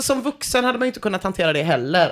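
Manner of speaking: ordinary talk, nothing marked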